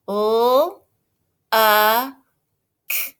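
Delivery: each sound of "uh" stretched apart. Shown as a demonstration. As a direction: tap out U, uh, k slowly. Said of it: "Here we go".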